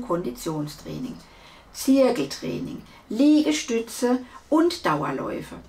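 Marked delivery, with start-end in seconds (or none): none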